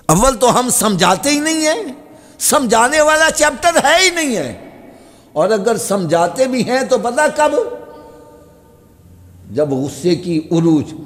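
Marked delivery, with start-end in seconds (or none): 0.0-7.5 high, impassioned pulpit pleading; full-force sermon voice; fiery preaching. Acted. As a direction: beg them to use sense.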